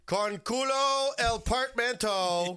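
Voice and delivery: slow, high-pitched hammed-up Italian-accented Spanish; thick Italian accent. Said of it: "biggest, thickest Italian accent ever".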